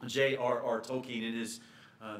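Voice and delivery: ordinary talk, nothing marked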